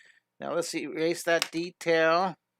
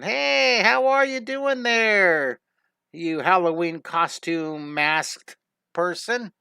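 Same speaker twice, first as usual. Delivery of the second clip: much higher, louder, slow sing-song greeting; waving cartoon character; 3 semitones higher, 5 dB louder, slower than usual. Little waving character hails the masked girl, loud and sing-song. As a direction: stretch it out like a goofy waving cartoon.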